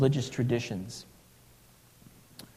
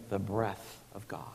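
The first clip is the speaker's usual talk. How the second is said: breathy hushed whisper on breath of God; 5 semitones lower, 11 dB quieter than usual. Acted. Preacher whispering to the congregation about God's breath. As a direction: let it fall to a whisper.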